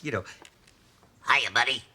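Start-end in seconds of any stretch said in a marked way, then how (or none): 1.3-1.8 frog voice. Retold or imitated. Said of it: "I used to do this weird frog voice".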